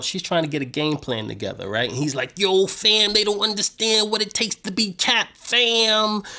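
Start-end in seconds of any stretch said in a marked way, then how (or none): 2.4-6.2 pouting. Retold or imitated. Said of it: "He's pouting".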